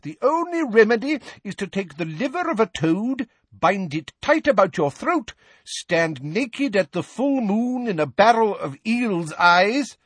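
put-on high, theatrical old-world healer; medieval wizard. A narrator's reading. Portrait healer prescribing a horrible remedy, high medieval wizard.

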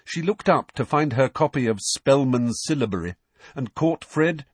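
ordinary delivery

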